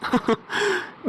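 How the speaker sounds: high, rising villain laugh; gloating laugh. Acted. Villain mocking the pleading woman, a high gloating laugh. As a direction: laugh down at her like you've won.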